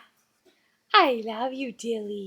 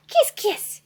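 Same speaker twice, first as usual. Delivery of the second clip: ordinary delivery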